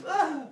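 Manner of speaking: high sharp cry when slapped; grito agudo al recibir una bofetada; yelp of pain. Acted. Slapped human yelps.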